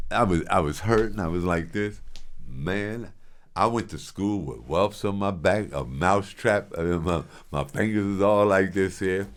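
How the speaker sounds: ordinary talk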